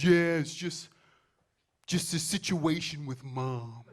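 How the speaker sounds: put-on slow, weary kid voice; weary little boy. Son complaining about mom, slow and weary. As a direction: sigh it out like a tired little man. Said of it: "he goes".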